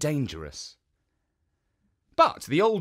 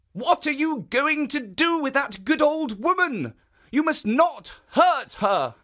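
higher, firm guardian warning; protective statue; 13 semitones higher, 5 dB louder than usual. Acted. Statue warning the Oni not to hurt the woman, firm. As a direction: stand up for her firmly.

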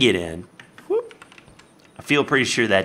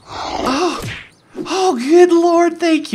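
high, loud, slow cry of relief; relieved outcry; 7 dB louder, much slower than usual. Real, not acted. Player relieved the zombie left, high loud relief. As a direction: shout thanks to heaven.

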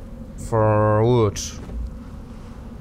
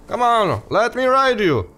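playful pleading; 9 semitones higher than usual. Real, not acted. Coaxes a creature to be ridden.

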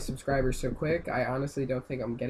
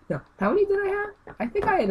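very high startled question; alarmed realization; 3 dB louder, faster than usual. Real, not acted. Gamer panicking about his lives, high yelp. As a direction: yelp as you count lives.

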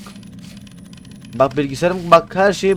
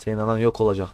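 normal talking voice

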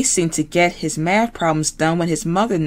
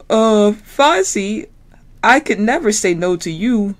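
Kermit giving in; 4 semitones higher than usual. Acted. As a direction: yield with a sigh of a frog.

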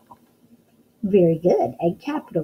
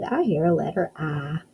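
ordinary delivery

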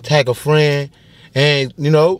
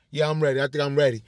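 normal talking voice

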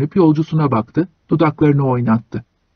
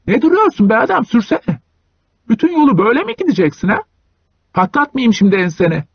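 angry yelling; 8 semitones higher than usual. Acted. Hunchback yelling at driver.

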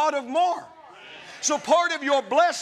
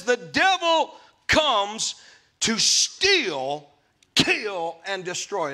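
preaching; 3 semitones lower, much slower than usual. Acted. Quotes scripture slowly.

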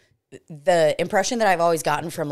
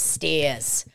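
"Stairs" with dropped R, Boston style; imitated Boston "Stairs"; Boston accent. Echoing the lady's word, Boston accent. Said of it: "That's what it is".

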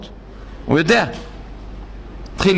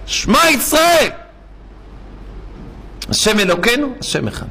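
retold cry; 6 semitones higher than usual. Dying father crying out from his hospital room, retold cry. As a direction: act out a cry of Shema, pitch leaping up. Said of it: "צעקה".

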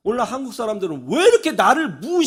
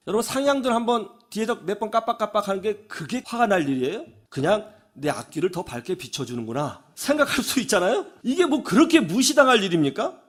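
ordinary delivery

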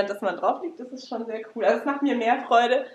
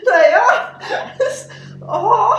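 laughing in pain; 13 semitones higher, 8 dB louder, much slower than usual. Real, not acted. Massage gun hits painful spot.

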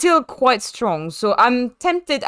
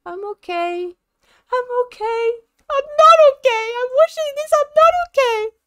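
high, strained imitation of a shaken driver; hammed-up shaken racer on the radio; mock distressed Pierre; 12 semitones higher than usual. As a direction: whimper it like a driver saying he's fine but isn't. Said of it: "heard him in the car".

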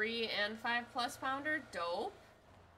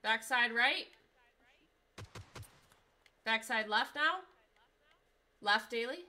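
loud callouts; 7 dB louder than usual. Real, not acted. Directing teammates.

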